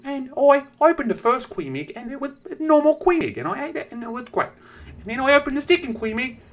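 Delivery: lightly mocking high kid voice; little kid voice. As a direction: mimic a ten-year-old boy telling his story. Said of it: "And he's like".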